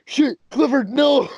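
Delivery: high, alarmed cry of no; startled, shouted warning; mock alarmed shout. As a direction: yelp it like a startled friend. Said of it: "they're like".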